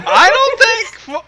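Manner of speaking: high, slow laughing words; laughing at the lemon tart joke. Real, not acted. Laughing disbelief.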